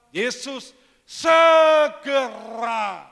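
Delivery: slow, loud shouted name. Acted. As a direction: shout it stretched out. Shouted emphasis. (teriakan panjang)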